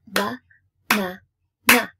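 BA, NA, NA as short separate claps of sound; pausing on each beat. Shown as a demonstration. Teacher clapping out the word for pupils, pausing each beat. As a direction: pause after each beat of "ba, na, na". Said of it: "pause on the beat".

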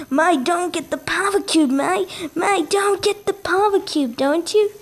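climbing pitch, hammed-up Australian accent; mock Australian accent. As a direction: pester a mate in a playful Australian accent. Repeats line in put-on accent, climbing.

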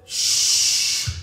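a sharp unvoiced hush; disapproving crowd hushing the blind man; crowd shushing. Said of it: "the crowd says".

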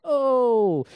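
drawn-out high "oh"; mock realization. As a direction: act out the assignment sinking in. Reacting to God's new assignment.

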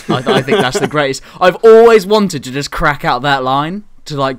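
ordinary talk

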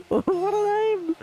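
very high squealing laugh through the words. Real, not acted. Streamer reacting to a player's silly name, squealing with laughter. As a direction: squeal it out while cracking up.

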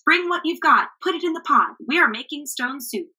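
high, eager rallying chant; rally the crowd to chip in; cheerful call. A narrator's reading. Travelers urging the villagers to bring food, cheerful call. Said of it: "shouted the travelers".

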